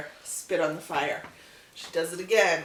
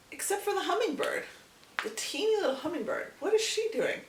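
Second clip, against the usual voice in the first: high storytelling voice; 13 semitones higher than usual. A narrator's reading. Narrating tiny hummingbird, rising.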